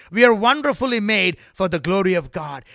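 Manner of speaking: loud proclamation. Acted. Preacher to congregation, proclaiming loudly.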